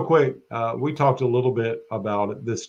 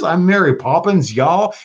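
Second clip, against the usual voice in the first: put-on higher, Southern-accent repeat of the catchphrase; Southern accent Yondu; 7 semitones higher, 4 dB louder than usual. Repeating Yondu's favorite line to the host, high Southern accent. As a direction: relish the Southern accent, pitched up. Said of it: "he had the Southern accent".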